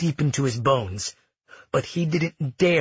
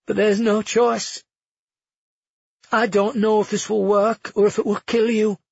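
strained; 7 semitones higher, 3 dB louder than usual. A narrator's reading. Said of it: "Her voice broke off".

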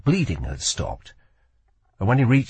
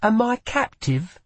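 put-on higher, sharp girl voice; young woman voice; 11 semitones higher than usual. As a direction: voice a wary young thief. Lila asks his name.

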